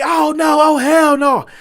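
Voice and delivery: high, loud shocked cry; outraged disbelief. Retold or imitated. Waiter crying out as his phone gets broken, high and loud. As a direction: react like your phone just snapped.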